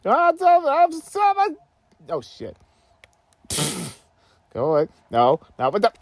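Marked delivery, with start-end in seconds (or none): 0.0-1.6 high, mocking challenge; higher taunt. Real, not acted. Taunting opponent in game.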